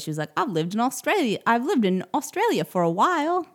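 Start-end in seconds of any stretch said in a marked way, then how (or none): 0.4-1.4 mock Australian accent. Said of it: "I heard an accent from New Zealand or Australia". 1.5-3.5 badly faked Australian accent, pitched high; hammed-up stab at sounding Australian; terrible Australian accent. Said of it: "That was terrible".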